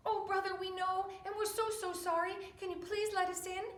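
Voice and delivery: high, begging apology; pleading little pigs. Acted. Little pigs apologising to their brother, high and pleading. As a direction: beg sweetly for forgiveness.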